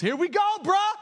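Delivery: loud, high, pumped-up battle cry; hyped shout. Acted. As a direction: fire up your buddy before the charge. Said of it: "Jonathan turns to his armor bearer and goes".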